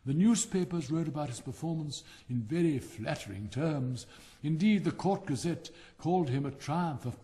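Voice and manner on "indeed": ordinary delivery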